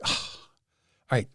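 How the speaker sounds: imitating a breathy, drawn-out sigh; exasperated giving in. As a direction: give in with a long exasperated exhale. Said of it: "rolls his eye".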